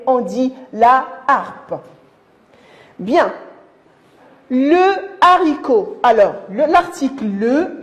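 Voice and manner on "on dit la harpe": "la harpe" slow, la held, no elision; slowly, la then harpe apart; aspirated h demo. Shown as a demonstration. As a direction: keep la whole before harpe. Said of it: "le H est un H aspiré".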